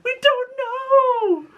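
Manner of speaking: very high, drawn-out kiddie wail; terrified children. Retold or imitated. Five terrified munchkins answer the teacher, high frightened wail. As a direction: squeal it slowly as scared little munchkins. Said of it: "that's how they talked all the time".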